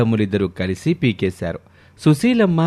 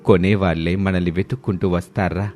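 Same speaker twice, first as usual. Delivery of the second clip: put-on lower, older motherly voice addressing the village; elderly mother-in-law; 4 semitones lower than usual. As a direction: speak like a wise old village matriarch.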